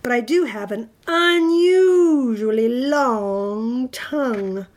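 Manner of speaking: loud, slow, slobbery monster boast; swelling, slurpy monster voice; slurping monster. A narrator's reading. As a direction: stretch out the long words hugely. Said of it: "Mac slurped".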